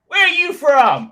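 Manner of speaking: very high, loud, slow mock American; terrible American accent. Local man quizzing an Australian visitor, hammed-up American accent. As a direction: stretch it out in a caricatured American accent. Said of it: "that terrible American accent".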